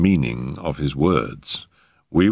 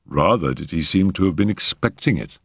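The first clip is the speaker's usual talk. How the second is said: plain reading voice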